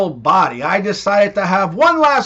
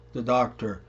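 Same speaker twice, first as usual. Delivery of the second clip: usual speaking voice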